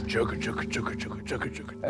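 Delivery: soft whispered train chugga; hushed chugga-chugga train imitation. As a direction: breathe the train noise under your voice.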